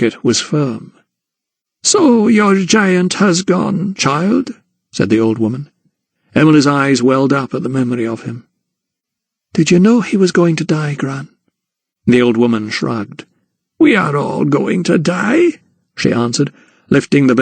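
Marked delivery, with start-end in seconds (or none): 1.8-4.5 put-on slow, high old woman's voice; a frail gran speaking slowly; old grandmother. 9.5-11.3 lightly voiced girl, higher; a hint of a worried girl; Emily asking her gran, lightly voiced; young Emily. Emily asks grandmother. 13.8-15.6 put-on slow, higher old woman; a lightly voiced gran, unhurried; old grandmother. Grandmother answers.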